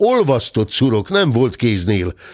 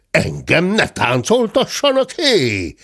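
very high wailing protest; high, howled complaint; bawling cub. A narrator's reading. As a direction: bawl like an outraged little bear. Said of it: "bömbölt Zebi".

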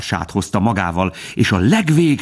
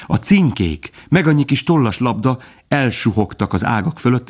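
ordinary delivery

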